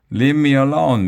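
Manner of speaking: a loud, high, furious yell; angry shout. A narrator's reading. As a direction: yell it to push them away. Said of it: "Miss Jojo shouted".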